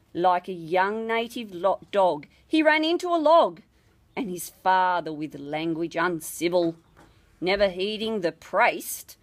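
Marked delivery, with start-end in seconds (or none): none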